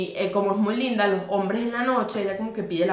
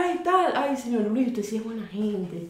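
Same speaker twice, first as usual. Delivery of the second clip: ay aguda y coqueta, luego se apaga; seductora Sayona; 2 semitones higher, slower than usual. Acted. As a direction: engatusa al señor Luis con dulzura. Sayona voiced sweet-talking driver. (Sayona halagando al señor Luis en el carro)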